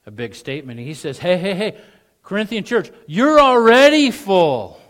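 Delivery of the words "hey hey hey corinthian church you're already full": hammed-up sarcastic flattery. Shown as a demonstration. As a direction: lay the sarcasm on thick. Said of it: "I'll give you my best sarcasm".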